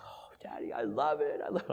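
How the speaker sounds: put-on breathy little-girl whisper of delight; whispered child's delight. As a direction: whisper it like an excited small girl. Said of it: "and she goes".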